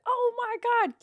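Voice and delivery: high-pitched mock dismay; freaking out. Customer can't get the bar back in, high mock freak-out. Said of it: "freaking out, but, like".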